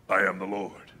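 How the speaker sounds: low, solemn scripture declaration; God's solemn signature line; solemn refrain. Acted. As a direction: drop low and state it with weight.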